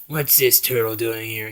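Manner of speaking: lightly voiced low tough frog; a hint of a low, tough-guy voice; Genghis's tough voice. Genghis asks about the turtle, lightly voiced tough. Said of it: "He sounds, like, a lot tougher".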